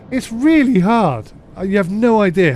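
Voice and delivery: high exasperated wail. Real, not acted. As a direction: whine it out, really overwhelmed. Exasperated about covering genres.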